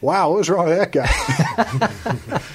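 slow, laughing retelling of the son's thought; laughing through it. Retold or imitated. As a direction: drawl it out while cracking up. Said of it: "my son is thinking".

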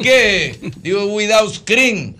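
high, loud, very slow "Without scream"; loud repeat. Retold or imitated. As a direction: spell it out slowly and loudly to the waiter. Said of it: "Yo decía". (repetir "Without scream" alto, fuerte y muy despacio)

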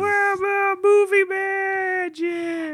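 high showman shout. Acted. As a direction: proclaim it like a showman. Frank shouts slogan.

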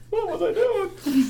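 high, slow, strained mock panic; high squeaky panic about the resignation; mock panicked squeak. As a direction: squeak it out in disbelief. Morning after resigning panic.

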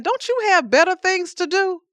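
high, loud exasperated outburst at God; a fed-up man yelling at the sky; exasperated Job. Acted. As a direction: throw it up at heaven, fed up. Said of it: "I'm angry".

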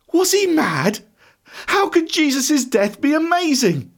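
high-pitched, appalled disbelief; incredulous. A narrator's reading. Thomas aghast at his friend's joy, incredulous.

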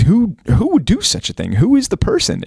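high, indignant king demanding who did it; retold angry king.

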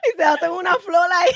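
laughing out the words, high and loud. Real, not acted. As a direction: laugh through the whole line. Laughing through the line. (riendo mientras habla)

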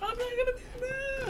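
unconvincing high-pitched denial; voice going up. Real, not acted. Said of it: "your voice is going up".

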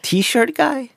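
high disbelieving echo; shocked echo. Retold or imitated. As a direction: repeat it in horror. Shocked at the label.

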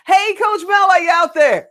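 loud calling out. Real, not acted. Calling out to coach.

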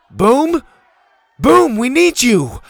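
high, loud call for help. Acted. Bo searching the group for the strongman, urgent calling. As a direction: holler over a crowd for someone.

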